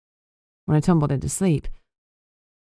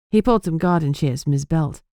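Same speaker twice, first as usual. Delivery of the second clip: normal talking voice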